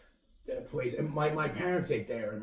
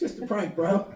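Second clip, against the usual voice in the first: mock prank bro; 9 semitones higher, 4 dB louder than usual. Mocking prank YouTuber catchphrase.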